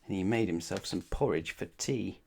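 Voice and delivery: usual speaking voice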